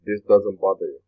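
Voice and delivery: normal talking voice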